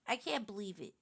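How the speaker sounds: normal talking voice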